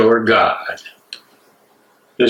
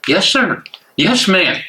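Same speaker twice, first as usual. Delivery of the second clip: mock humble servant, pitched higher; a plumber bowing to rich clients, meek and higher; meek servile yes; 8 semitones higher than usual. Plumber mocking his own old servility, meek and higher. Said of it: "I would say meekly".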